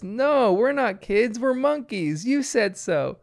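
put-on high, insistent kid voice; defiant child. Bluey corrects Dad from the car, playfully defiant. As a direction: imitate a girl talking back to Dad. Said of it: "And Bluey says".

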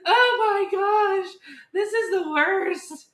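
high, slow, drawn-out wail; hammed-up weepy lament, stretching every word; mock heartfelt cry. Mom retelling finding teacher's postcard, mock heartfelt wail. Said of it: "like, a heartfelt cry".

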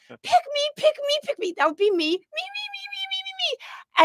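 hammed-up pick-me voice, begging to be chosen; eager attention seeker. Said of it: "there are some people that are like".

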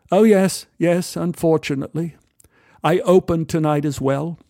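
a polite English gent's greeting; English accent. Retold or imitated. English stranger greets narrator at the bar, lightly English. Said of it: "He, he was English".

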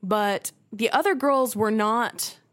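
ordinary talk, nothing marked

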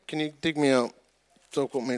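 ordinary talk, nothing marked

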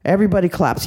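ordinary delivery

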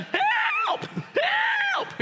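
very high, loud panicked yells; cry for help. Retold or imitated. Drowning boy coming up out of the water, high panicked yelling. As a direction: yell for help as you surface. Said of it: "came out".

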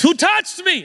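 loud-sounding demand, pitched very high; stern Jesus. Acted. Jesus confronting whoever touched him, stern. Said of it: "we hear him in his stern voice".

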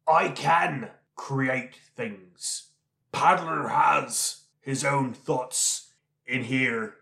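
slow and firm, each word weighted; slow, emphatic. Acted. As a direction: insist on it, word by word. Insistent slow declaration.

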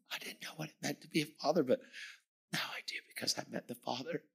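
soft, breath-held near-whisper; moved hush. Real, not acted. Moved testimony about fatherhood.

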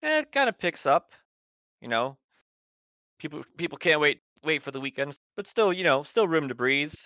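sweet small-town bartender; Eileen the bartender. Acted.

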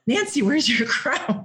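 high, slow teasing question with a laugh; playful athletes. Retold or imitated. Athletes teasing Nancy about her crown, high and laughing. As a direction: tease her like a delighted fan.

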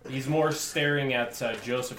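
ordinary talk, nothing marked

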